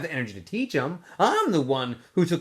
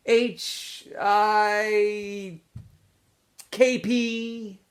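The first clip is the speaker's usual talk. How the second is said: tentative wrong guesses; 8 semitones higher, much slower than usual. A narrator's reading. Zero guesses letters wrong.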